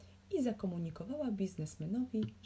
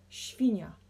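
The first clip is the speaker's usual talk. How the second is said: ordinary delivery, nothing marked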